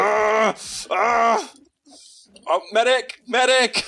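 screaming for a medic; wounded soldier gasping. Acted. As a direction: yell for help, hit and down. Downed player calls for medic.

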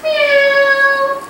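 high, drawn-out meow; long high cat cry. Shown as a demonstration. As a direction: imitate a kitten calling. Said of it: "Kitty cat says".

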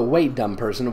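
normal talking voice

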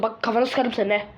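ordinary talk

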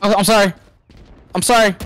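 high-pitched fake sorry; mock apology. Player apologizing in game before taking it back, mock-sorry.

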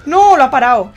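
furious shout at the game; raging gamer. Real, not acted. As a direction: yell at the screen in anger. Gamer losing a play.

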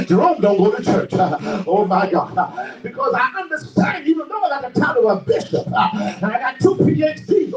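rousing sermon delivery, punctuated with gasped uhs; impassioned preacher working up the congregation; fiery preaching. Real, not acted. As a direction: preach it hard in rhythmic bursts. Preaches at full force.

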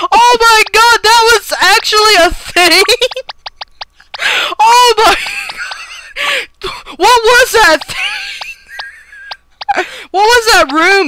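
high, loud disbelief through laughter; cracking up in shock; laughing hard. Real, not acted. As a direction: gasp words out between laughs. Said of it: "I shouldn't be laughing this much".

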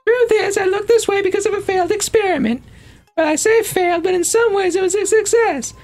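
high-pitched, chatty character voice; high cartoon scientist; annoying game character. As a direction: put on an annoyingly high, perky little voice.

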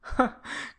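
put-on a short soft breathy boyish laugh; a quick, airy young man's chuckle. A narrator's reading. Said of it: "Gilbert laughed boyishly".

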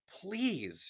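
very high, drawn-out plea; desperate pleading; begging. A narrator's reading. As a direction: beg with pitch leaping, then falling. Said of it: "she begged".

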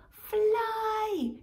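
high loud urgent squawk. Acted. Magpie squawks at Robin to fly. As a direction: screech the order. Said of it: "squawked the magpie".

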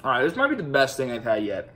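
speaking between bites; talking while chewing. Real, not acted. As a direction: mouth partly full of food. Seen 0: Bites marshmallow at -3s; hand covers mouth while speaking, likely chewing.

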